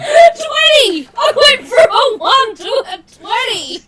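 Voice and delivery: real high-pitched, very loud cheer; laughing, squealing disbelief; ecstatic shout. Real, not acted. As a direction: shriek it out, overjoyed at a lucky roll. Real joy at a lucky dice roll.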